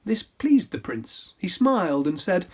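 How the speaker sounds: ordinary delivery, nothing marked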